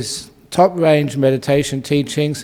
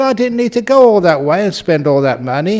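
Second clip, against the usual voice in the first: lightly acted, higher and louder realisation; mild mock disbelief; 6 semitones higher, 5 dB louder than usual. Young man realises his costly trip was needless, mildly incredulous. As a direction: imitate a touch of indignant surprise.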